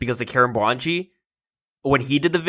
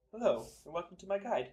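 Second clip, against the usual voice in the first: far off and much softer, as if down a hall; through a tube; 6 semitones higher, 15 dB quieter than usual. Retold or imitated. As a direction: speak it through a tube, distant. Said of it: "speaking into a tube down a hall".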